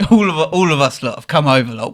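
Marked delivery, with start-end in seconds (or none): none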